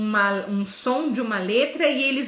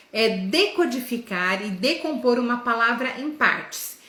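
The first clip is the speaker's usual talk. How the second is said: ordinary talk, nothing marked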